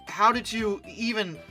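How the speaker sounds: usual speaking voice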